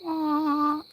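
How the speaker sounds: high nasal-free buzzy wah like a kazoo blast; kazoo imitation. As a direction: toot like a referee's kazoo. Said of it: "keep my kazoo in my pocket".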